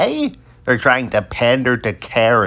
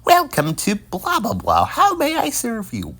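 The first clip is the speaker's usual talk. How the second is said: scripted service greeting; 11 semitones higher than usual. Retold or imitated. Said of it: "instead of the".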